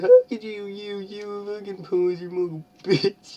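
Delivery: high, loud hammed-up yell; mock shout. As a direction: scream it like a betrayed emo boy. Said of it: "he shouted".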